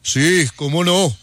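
slow, put-on voice for the joke; lightly voiced slow-talking man; fishmonger's own voice. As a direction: draw the answer out in character. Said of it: "responde así con su voz".

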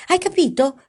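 loud, high furious shout; angry shout. A narrator's reading. Paolo yells at frightened Mara, angry shout. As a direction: snap it at her in anger. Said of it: "gridò Paolo".

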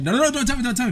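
high-pitched little brother pleading; imitated urgent protest. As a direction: cut them off in a high, pleading rush. Little brother refusing spoilers.

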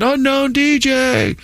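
high, slow fan call from the stands; mock bleacher call. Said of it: "from the bleachers that said".

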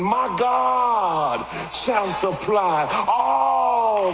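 shouted, drawn-out pulpit cry; fiery preacher reaching a peak; preaching at full force. Acted. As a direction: preach it loud and high, stretching the words.

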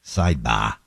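low, drawn-out Boston "Sidebar" with no r; Boston accent. Retold or imitated. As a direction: imitate the lawyer's Boston "Sidebar". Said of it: "in his Boston accent".

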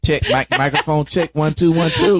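loud, high mock sound check; playful mic check. Butting in on the teasing storyteller, playful mic check.

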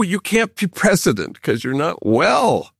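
ordinary delivery

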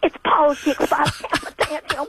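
high, wailing mock speech with laughter, rising into a mock cry; mocking impression of a preacher-politician, high and laughing into a fake sob; mocking impression of Pearson. Mocking Pearson after clip.

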